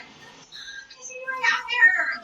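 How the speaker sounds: put-on teen spotting her ex where he shouldn't be, baffled; baffled outburst. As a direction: gape in disbelief at him being there. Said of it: "So I was like".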